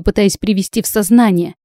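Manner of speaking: usual speaking voice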